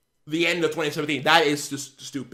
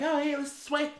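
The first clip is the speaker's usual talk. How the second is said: mocking kid voice; 7 semitones higher, 9 dB quieter than usual. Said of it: "decide, like".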